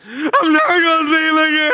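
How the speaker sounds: high, loud, sobbing cry; grieving friend wailing on a plane. Retold or imitated. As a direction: wail it out loud in grief. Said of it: "he's loud".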